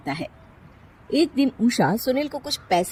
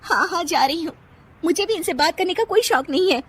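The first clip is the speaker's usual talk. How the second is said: higher, huffy retort; sulky sarcastic bride; 9 semitones higher than usual. Acted. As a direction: storm off with a jab. Nisha leaves sulking. (चिढ़कर Tana Maruti बहू)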